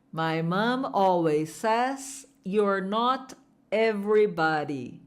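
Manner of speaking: the whole sentence slow and clear; slow full sentence. Shown as a demonstration. Teacher modelling the full sentence slowly aloud. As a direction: model it slowly for students to repeat.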